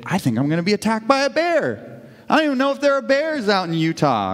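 mock panic, pitch climbing sharply; acting out a mild fright, voice jumping high; retold alarm. Preacher voices his wife fearing a bear attack, mildly panicked. Acting out wife's sudden fear of bears.

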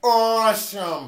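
loud, slow drawn-out awesome; mock impression. Friends trade impressions, slow loud drawn-out awesome. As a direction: stretch it out big and loud. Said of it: "how many impersonations I can kinda do".

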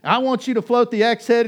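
high, loud, desperate plea to God; loud prayer. Retold or imitated. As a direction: shout the prayer out across the field, pleading. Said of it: "And I said".

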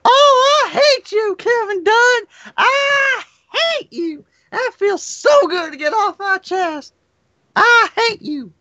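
very high, wailing falsetto impression; Percy Pringle. Pringle venting at Kevin Dunn, high wailing mock hatred. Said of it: "Pringle was like".

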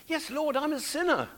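high-pitched cry of confession; praying as David. Acted. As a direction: cry out to God as a guilty sinner. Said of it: "This is him".

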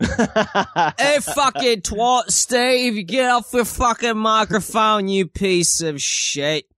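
husband yelled at by wife in a high put-on voice; mock wife. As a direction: ham up the furious wife. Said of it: "how I picture your wife".

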